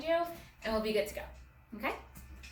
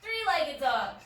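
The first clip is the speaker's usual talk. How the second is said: loud, higher pose calls; faster loud calls; 5 semitones higher, 6 dB louder, slower than usual. Real, not acted. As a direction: lead the fast round loudly. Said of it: "shouting really loud".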